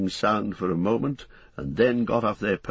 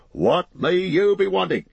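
high-pitched, hearty landlord's greeting; bustling innkeeper; 10 semitones higher, 5 dB louder than usual. Acted. Landlord to a newly arrived hobbit, bustling welcome. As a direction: greet the guest in a high, lively character voice.

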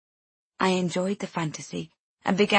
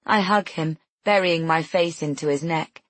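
plain reading voice

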